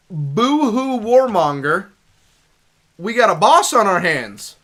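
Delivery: loud, high, dramatic announcement; boss alert. Real, not acted. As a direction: call out the big threat.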